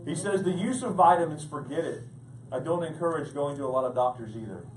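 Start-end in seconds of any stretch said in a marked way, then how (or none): none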